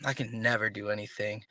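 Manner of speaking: low, soft, deflated self-pity after a sigh; sigh, then drop pitch and volume, defeated. Shown as a demonstration. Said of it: "You lower the tonal pattern".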